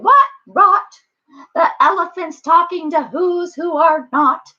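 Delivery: high, loud scoffing; shouting monkeys. A narrator's reading. Monkey brothers deriding Horton, high scornful shout. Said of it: "came shouting".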